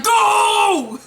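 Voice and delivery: sharp high shout, go; high urgent yell of go; shouted urging. Retold or imitated. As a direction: yell at him to run. Urging him to run.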